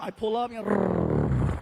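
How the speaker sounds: ordinary delivery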